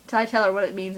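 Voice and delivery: usual speaking voice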